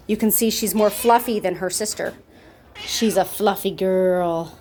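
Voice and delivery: ordinary delivery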